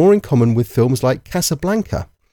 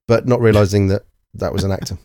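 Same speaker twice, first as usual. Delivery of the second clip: usual speaking voice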